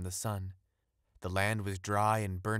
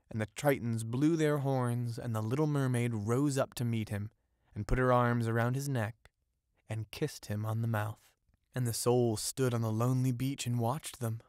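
ordinary delivery

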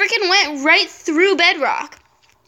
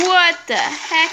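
normal talking voice

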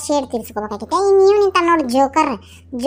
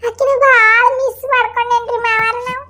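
crying as she begs father-in-law; tearful plea; 6 semitones higher than usual. Acted. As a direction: plead through tears. Daughter-in-law pleads, crying. (ಅಳುತ್ತಾ ಬೇಡಿಕೊಳ್ಳುವುದು)

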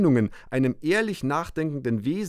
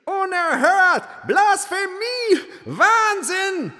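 outraged shouts; 4 dB louder, much slower than usual. A narrator's reading. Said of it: "schallte es durcheinander".

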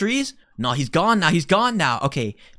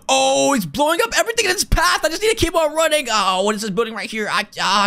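ordinary talk, nothing marked

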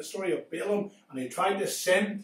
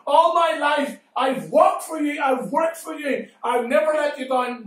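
pleading donkey; 4 semitones higher than usual. Acted. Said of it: "and the donkey said".

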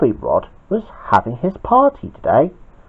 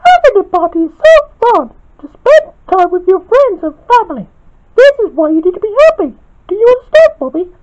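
fast, very high falsetto lecture; piercing character voice, brisk; Spinel; 5 dB louder, faster than usual. A narrator's reading. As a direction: preach in a loud squeaky voice. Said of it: "asked Spinel".

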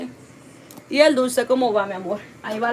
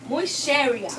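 mock serious voice; 3 semitones higher, slower than usual. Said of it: "yo soy una mujer muy seria".

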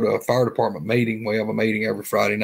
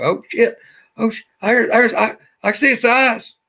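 spooked friend; 9 semitones higher, 3 dB louder than usual. Retold or imitated. Friend spots eyes in the dark.

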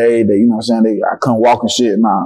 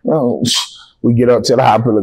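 usual speaking voice

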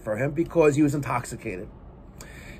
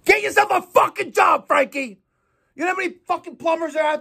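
furious shout; 11 semitones higher than usual. Real, not acted. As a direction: blast him with a furious yell. Ranting at absent employee Frankie.

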